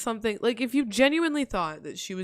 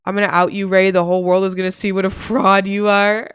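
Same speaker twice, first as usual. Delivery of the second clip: loud, hammed-up threatening rant breaking into laughter; loud mock threat; 11 dB louder than usual. Streamer mimicking Dore's messages to Ray, loud mock threat. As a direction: belt out a mock threat, amused. Mockingly re-reads the angry message.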